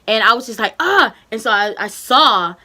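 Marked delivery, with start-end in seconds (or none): 0.8-1.1 retold scream. Burning pain during anesthesia.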